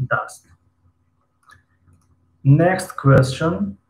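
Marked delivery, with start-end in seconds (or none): 2.4-3.8 next question drawn out very slowly; slow English prompt. Shown as a demonstration. Teacher slowly announcing the next question. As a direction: stretch each word so students catch it. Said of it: "teacher reads next English prompt".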